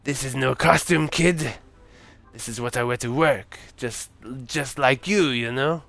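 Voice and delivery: put-on tipsy accented Santa voice; drunk French Santa. Drunk Santa defending his work clothes, halting accent. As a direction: insist on it with drunken pauses. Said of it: "it's a drunk French Santa".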